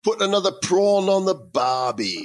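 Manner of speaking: high, hammed-up Aussie voice; Australian accent. To co-host about Australian mates, mock Aussie accent. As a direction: ham up a cheesy Australian cliché.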